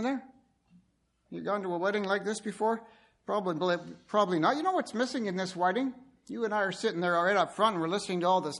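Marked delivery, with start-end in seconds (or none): none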